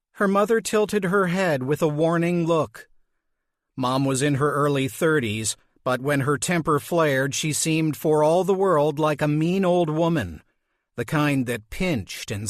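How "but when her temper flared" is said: usual speaking voice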